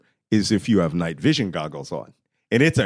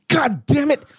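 exasperated; 3 dB louder than usual. Retold or imitated. Exasperated reaction.

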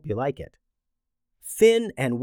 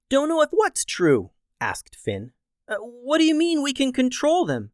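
put-on puzzled boy; 11 semitones higher than usual. Finn puzzled, voiced high.

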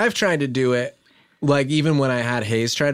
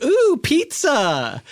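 imitating an excited food lover, high and drawn out; mock pizza excitement; 12 semitones higher, much slower than usual. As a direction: stretch out a teasing, high-pitched squeal of delight. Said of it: "you were hiding behind the counter and you went".

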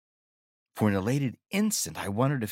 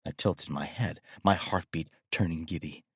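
ordinary delivery, nothing marked

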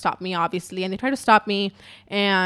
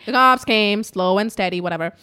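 ordinary talk, nothing marked

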